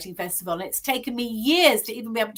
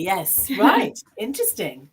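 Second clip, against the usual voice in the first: usual speaking voice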